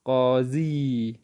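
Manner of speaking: قاضی stretched slowly and clearly; teacher models قاضی slowly; slow model word. Shown as a demonstration. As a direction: give the class قاضی slowly. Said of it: "تو جج کو فارسی میں کیا پڑھیں گے ہم؟".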